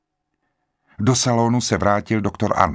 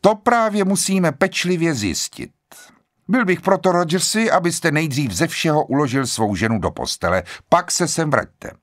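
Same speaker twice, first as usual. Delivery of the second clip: measured authority in a raised pitch; higher, firm voice of the bench; authoritative; 8 semitones higher than usual. A narrator's reading. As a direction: take charge like a seasoned judge. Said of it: "Promluvil s autoritou".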